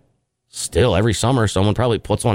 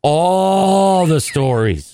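exasperated, long drawn-out ALL, pitched higher; slow, higher, exasperated emphasis on ALL; irritated; 5 semitones higher, much slower than usual. Real, not acted. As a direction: stretch out ALL as if fed up. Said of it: "You sound irritated by it".

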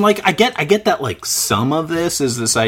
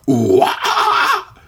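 high-pitched imitated scream; mock metal scream; 4 dB louder than usual. Imitating a hit song's singer going off, mock scream. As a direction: throw out a joking nu-metal wail. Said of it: "everyone was screaming".

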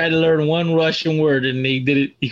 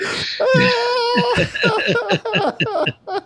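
shrill crying laugh, way up high; laughing till it squeaks; high wheezing laugh. Real, not acted. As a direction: break into a helpless high-pitched cackle. Cracking up after the joke.

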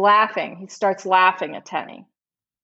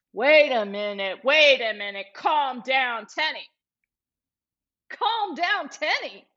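retold defensive warning, rising higher; imitated defensive aggression; 4 semitones higher than usual. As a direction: pitch climbing on each plea to calm down. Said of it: "defensive aggressive towards him".

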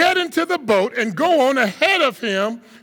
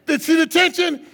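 ordinary delivery

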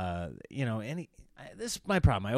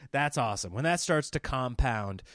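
ordinary talk, nothing marked

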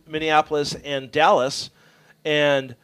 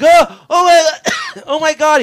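high, loud startled cry, acted out; freaking out; 11 semitones higher, 10 dB louder, slower than usual. Friend waking at the wheel against the guardrail, freaking out. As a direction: imitate someone jolted awake in panic. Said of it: "He freaks out".

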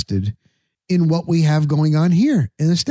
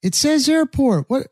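mock bafflement; 9 semitones higher than usual. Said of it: "and I'm like".

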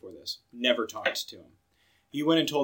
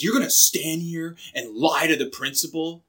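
raised, louder mock outrage; retold incredulous scolding, higher and louder; imitated indignant teacher; 5 semitones higher, 5 dB louder than usual. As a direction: challenge it like an affronted teacher. Voices indignant teacher before principal.

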